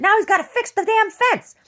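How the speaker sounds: high, loud put-on scolding voice; telling off a bull in a high, loud cartoon voice; mock scolding. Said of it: "I said".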